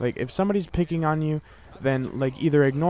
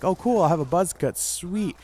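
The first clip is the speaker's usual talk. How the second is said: mock excited kid; 5 semitones higher than usual. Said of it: "I'm like".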